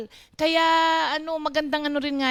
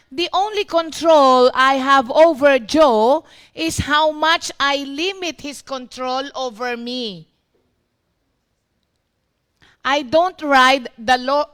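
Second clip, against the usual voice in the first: slow emphatic reading; 8 dB louder than usual. Retold or imitated. Reading Mike's quoted words.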